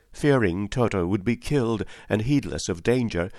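plain reading voice

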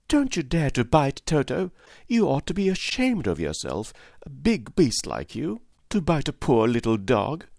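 put-on high, scolding little-girl voice; indignant cry. A narrator's reading. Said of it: "while she cried out".

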